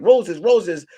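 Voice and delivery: high, loud sing-out to passers-by; mock street seller's cry; street vendor's call. Street flower seller calling out.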